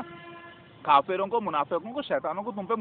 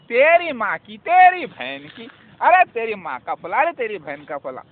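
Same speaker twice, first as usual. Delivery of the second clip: loud, coarse imitation of a street curse; hammed-up imitation of crude swearing; mock abusive swearing; 2 semitones higher, 7 dB louder than usual. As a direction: spit out the insults like a lout mid-quarrel. Mimicking people who swear habitually.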